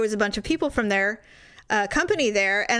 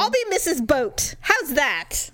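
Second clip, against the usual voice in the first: higher-pitched, playful spite; mock defiant taunt; 5 semitones higher, slower than usual. Taunting the jealous boyfriend about the boat guy, mock defiant. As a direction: toss it at him as a dare. Her taunt in retold argument.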